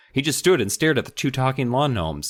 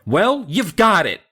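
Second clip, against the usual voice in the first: put-on louder, slow sarcastic girl retort; Mindy exclaiming; 8 semitones higher, 5 dB louder than usual. Mindy snapping at the gnomes, louder and slow. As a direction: bite back sarcastically. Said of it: "Mindy exclaimed".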